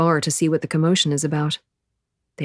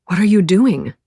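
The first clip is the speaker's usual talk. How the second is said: a louder, out-of-breath question; panting; 3 semitones higher, 7 dB louder than usual. Acted. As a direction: gasp it out after the swim. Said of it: "he pants".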